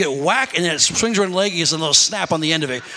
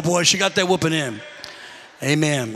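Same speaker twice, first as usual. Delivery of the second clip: ordinary talk, nothing marked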